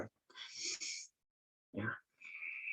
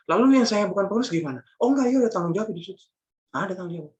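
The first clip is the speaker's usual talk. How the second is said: full-force preaching; 8 semitones higher, 4 dB louder than usual. Acted. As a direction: press the congregation hard. Preacher bursts out again.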